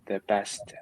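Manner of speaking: ordinary talk, nothing marked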